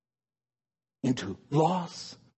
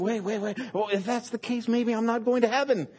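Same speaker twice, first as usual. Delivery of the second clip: sudden worried doubt; 6 semitones higher, faster than usual. Pretend. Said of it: "we start thinking".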